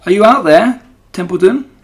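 loud, high call across the yard. A narrator's reading. Pig calling out into the rain for his friend. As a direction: call out for the rat. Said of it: "called Wilbur".

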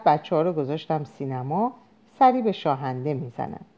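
plain reading voice

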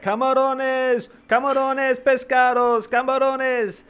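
a loud, high hawker's cry, slow and drawn out; street vendor calling out. Retold or imitated. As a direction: call your shrimp down the street like a vendor. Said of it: "a guy walking down the street calling out".

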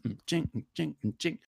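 soft mouthed ching sounds; mimicked guitar skank. Shown as a demonstration. Imitating offbeat guitar for co-hosts, very soft. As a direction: tap out the offbeat softly with your voice. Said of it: "offbeat skanks".

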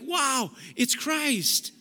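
high, delighted wow; joy of recognition; thrilled wonder. Pretend. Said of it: "You're like".

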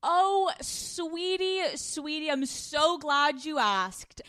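high, very drawn-out theatrical sweetie voice; mock-sweet delight. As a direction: gush playfully, stretching every word. Mock-delighted reply to the co-host's question.

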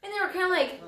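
normal talking voice

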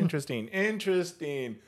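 high-pitched, soft mimicked catchphrase; podcast host impression. Demonstrating a podcast host's catchphrase, high-pitched impression. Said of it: "He does that. He goes".

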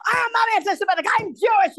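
high, loud, fast shout; indignant shout. Retold or imitated. Woman denying anti-Semitism to a trespasser, high indignant shout. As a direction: shriek it back in outraged denial. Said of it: "And she was yelling at me".

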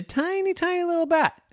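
squeaky high for tiny things. A narrator's reading. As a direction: make the bat sound teeny. Narrator stressing tiny bat.

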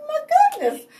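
surprised at himself. Retold or imitated. Speaker quoting himself asking God, high and astonished. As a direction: catch yourself mid-prayer, amazed. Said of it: "surprised when I caught myself, like".

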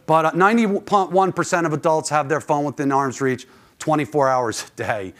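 ordinary talk, nothing marked

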